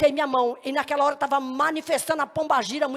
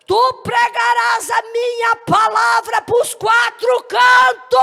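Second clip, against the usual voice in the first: very high, drawn-out prophetic shout; prophesying; 7 semitones higher, 8 dB louder, much slower than usual. Retold or imitated. God commissions the young preacher through the woman, loud soaring prophecy. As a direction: thunder out the decree, stretching each word. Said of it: "tomou aquela mulher em profecia".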